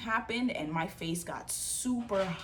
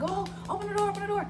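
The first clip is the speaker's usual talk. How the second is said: very high retold plea; panicked pleading; 14 semitones higher, much faster than usual. Bleeding girl calling to her cousin through the door, panicked. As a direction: re-enact begging to be let in. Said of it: "my face was covered in blood".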